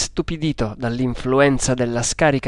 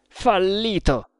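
frustrated cry; 6 semitones higher, slower than usual. A narrator's reading. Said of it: "gridò".

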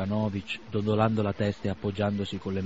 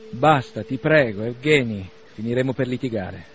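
louder, begging him to stop; pleading stop; 8 dB louder than usual. Acted. Arkady begging Bazarov to end the quarrel, loud. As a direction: cut the quarrel short, loud.

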